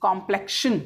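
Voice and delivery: ordinary delivery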